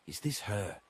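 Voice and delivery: usual speaking voice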